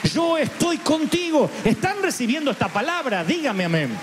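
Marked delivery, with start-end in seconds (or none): 0.1-1.5 gently voiced God; slow, high reassurance. God reassuring the believer at the bottom of crisis. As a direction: promise it slowly and lovingly. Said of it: "Él va a estar allí diciendo".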